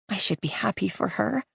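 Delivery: very soft, held-back thought; inner thought, hushed. Acted. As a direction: say it to yourself quietly.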